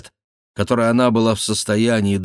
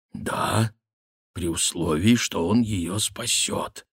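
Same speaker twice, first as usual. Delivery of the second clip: slow grave answer; 4 dB quieter, slower than usual. Acted. Baron answers Bussy gravely in dialogue.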